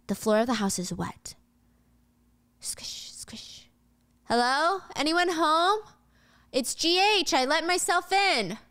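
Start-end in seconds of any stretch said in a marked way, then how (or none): 2.6-3.7 very soft whispered squelches; whispered sound effect. Retold or imitated. George walking on the wet floor, whispered squishes. 4.3-8.6 higher, lilting call into a house. Acted. George entering the neighbor's house, calling out.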